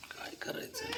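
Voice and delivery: whispered aside. Real, not acted. Owner whispering to himself about the stubborn cat, whispered. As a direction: breathe it under your breath, unvoiced.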